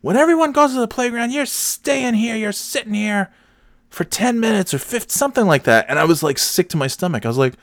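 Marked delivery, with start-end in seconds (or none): 0.0-5.9 very high, bossy mock scolding; stern playground monitor. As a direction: lay down the punishment in a shrill monitor voice. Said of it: "she's like".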